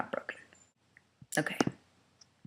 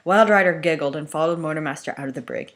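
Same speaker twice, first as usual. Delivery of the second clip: ordinary delivery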